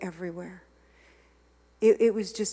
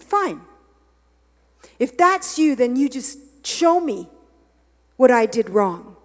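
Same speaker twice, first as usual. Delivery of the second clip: higher and louder, defiant, daring God to answer; angry challenge; 4 semitones higher, 10 dB louder than usual. Retold or imitated. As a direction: snap back at God in anger. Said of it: "And then I got mad".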